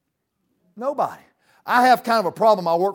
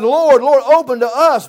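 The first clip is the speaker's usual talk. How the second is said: loud pleading cry; 5 semitones higher, 11 dB louder than usual. Acted. Shut-out crowd crying to the Lord, loud begging.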